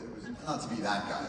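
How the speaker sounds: ordinary delivery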